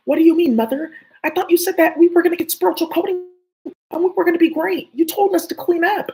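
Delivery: high, loud wounded protest; betrayed child voice. Acted. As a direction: cry out at mother, hurt. Acting a betrayed child to mother.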